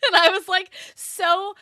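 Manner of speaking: laughing. Real, not acted. Laughing at own embarrassment.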